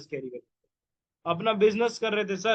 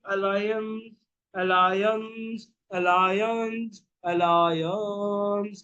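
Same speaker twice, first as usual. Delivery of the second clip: Alliance stretched very slowly each time; drawn-out word; much slower than usual. Shown as a demonstration. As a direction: hang on alliance like a teacher waiting. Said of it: "drawing word out repeatedly". (Alliance को खींचकर बहुत धीरे बोलना)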